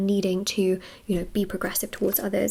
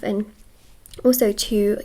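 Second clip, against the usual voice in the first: normal talking voice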